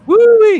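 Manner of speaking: high, loud "woo-wee" holler; mock Texas whoop. Co-host mocks the film's Texas accent, high hammed-up whoop. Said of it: "Yeah, that's Texas".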